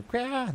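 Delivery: short, high imitation monster cry; imitated drake scream. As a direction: mimic a drake's screech in a high squawk. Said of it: "when they scream".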